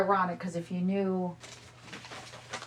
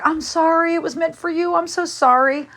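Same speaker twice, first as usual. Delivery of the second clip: higher, apologetic player voice; 10 semitones higher, 5 dB louder than usual. Retold or imitated. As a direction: say sorry eagerly, pitched up. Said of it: "And Stephen was like".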